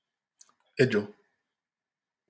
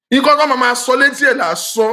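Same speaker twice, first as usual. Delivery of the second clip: fast shout; 10 semitones higher, 8 dB louder, faster than usual. Real, not acted. Heated preaching in Yoruba.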